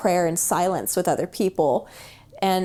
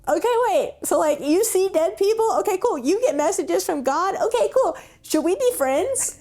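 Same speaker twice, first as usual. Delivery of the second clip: much higher, bubbly imitation of a friend; giddy mock excitement; 11 semitones higher than usual. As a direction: play it up as a gushing new friend. Retold friend's giddy acceptance, joking.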